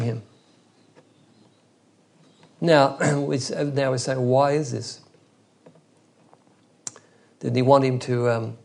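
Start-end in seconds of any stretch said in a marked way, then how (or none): none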